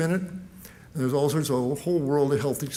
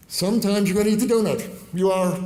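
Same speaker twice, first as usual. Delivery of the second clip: louder and higher, insisting; emphatic; 5 semitones higher, 5 dB louder than usual. Real, not acted. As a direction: drive the point home. Emphatic admission.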